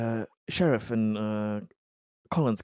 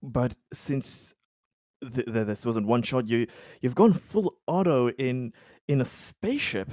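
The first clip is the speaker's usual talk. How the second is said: ordinary delivery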